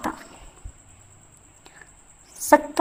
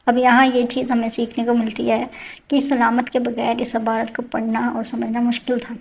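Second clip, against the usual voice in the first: normal talking voice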